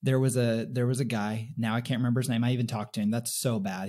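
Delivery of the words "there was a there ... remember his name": normal talking voice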